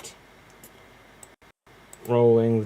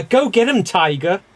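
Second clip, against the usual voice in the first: Mary Jane Watson; 6 semitones higher than usual. Acted. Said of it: "Mary Jane Watson".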